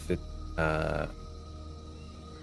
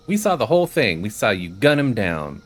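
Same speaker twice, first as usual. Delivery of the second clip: raised, high-pitched accusing read; mock accusation; 3 semitones higher, 6 dB louder than usual. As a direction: act out an outraged witness, voice lifted. Reading an accusation from game dialogue.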